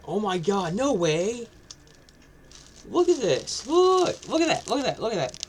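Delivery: high, loud excited squeal, then fading; shrieking jackpot winner. Real, not acted. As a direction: squeal like you just hit the jackpot. Unboxing, thrilled by tattoos.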